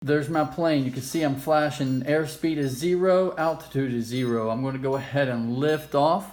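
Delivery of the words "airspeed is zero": normal talking voice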